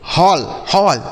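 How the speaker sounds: "हॉल" with an open aw, said twice; correct AW vowel. Shown as a demonstration. Teacher giving children the right reading, "हॉल" as hawl. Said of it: "इसे क्या पढ़ेंगे?".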